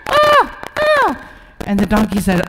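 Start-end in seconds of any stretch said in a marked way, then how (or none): 0.1-1.2 distressed duck. Acted. Stuck duck wails to the donkey. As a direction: wail from the mud.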